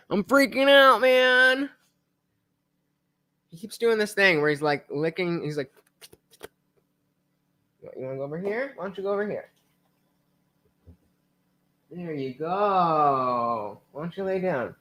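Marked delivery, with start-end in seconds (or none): 0.1-1.7 pitched way up, a mock panicked pet talking; mock dog voice. Owner speaking for his scared dog, high mock panic. 8.0-14.8 hushed, gentle coaxing, much softer. Real, not acted. Owner gently settling his frightened dog, soft coaxing.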